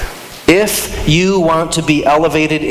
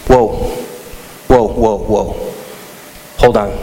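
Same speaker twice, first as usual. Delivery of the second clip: low, spaced-out stop command; stern halt; 5 semitones lower than usual. Retold or imitated. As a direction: imitate a pastor halting the game. Pastor halts the game, retold.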